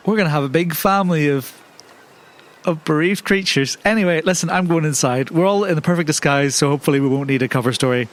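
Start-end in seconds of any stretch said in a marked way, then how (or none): none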